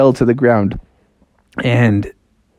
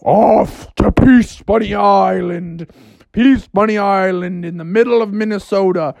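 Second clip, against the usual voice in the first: booming whirlwind; 7 semitones higher than usual. Acted. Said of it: "the whirlwind was like".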